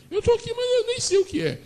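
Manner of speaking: high, fast, lost woman's voice; preacher playing the despairing mother, high and quick; distraught Agar. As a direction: Agar crying out that she doesn't know.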